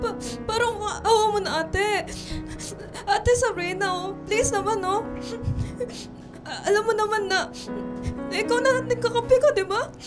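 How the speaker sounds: soft, broken crying plea; tearful pleading. Acted. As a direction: beg softly through tears. (umiiyak na nagmamakaawa)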